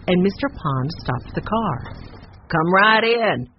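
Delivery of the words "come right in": calling out. A narrator's reading. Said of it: "called Mister Bates".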